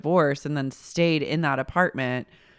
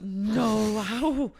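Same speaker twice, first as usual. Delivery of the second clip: high, hesitant little voice; a prissy, unsure question; prim Charlotte; 5 semitones higher than usual. Retold or imitated. Charlotte hesitating about more wine, high prim voice. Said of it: "Charlotte goes".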